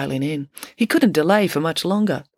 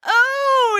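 very high, loud, drawn-out groan; disgusted exclamation; 5 dB louder than usual. A narrator's reading. Whole class reacts to the poop, exclaiming. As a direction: cry out high in grossed-out shock. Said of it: "exclaimed everyone in the classroom".

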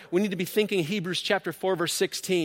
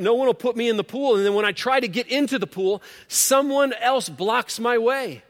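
whiny excuses; 6 semitones higher, 5 dB louder than usual. Retold or imitated. Sick man at the pool whines his excuses to Jesus. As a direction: plead your excuses like a self-pitying man.

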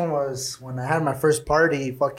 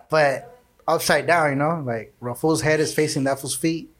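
ordinary delivery

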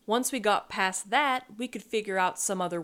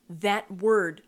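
ordinary delivery, nothing marked

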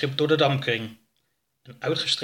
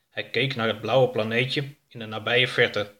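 usual speaking voice

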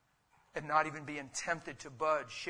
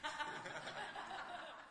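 real laugh, high and soft; 13 dB quieter than usual. Real, not acted. Pastor laughing at his Patty example, amused. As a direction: laugh at the joke you just made.

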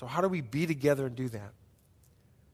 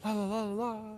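mocking singsong; 4 semitones higher, 7 dB quieter than usual. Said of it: "Sounds like".

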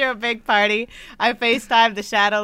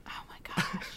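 whispering; 17 dB quieter than usual. Real, not acted. Whispered reaction.

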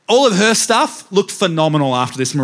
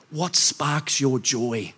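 slow, soft, unhurried asking; gentle Marie Kondo; 6 dB quieter, much slower than usual. Retold or imitated. Marie Kondo on her show softly asking what sparks joy, slow and soft. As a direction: ease the question out slowly, very gently. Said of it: "she would say to the person on the show".

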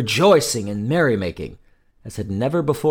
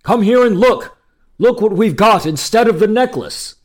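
high, loud, urgent summons; excited shout; 9 semitones higher, 6 dB louder than usual. A narrator's reading. King calling the queen over, excited shout. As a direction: shout for her to see, elated. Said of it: "shouted the king in excitement".

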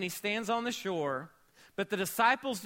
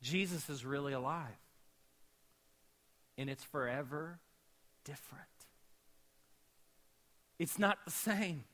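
hushed preaching; 5 semitones lower, 7 dB quieter than usual. Real, not acted. Quiet intimate sermon build.

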